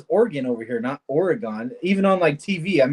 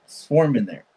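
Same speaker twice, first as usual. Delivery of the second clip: normal talking voice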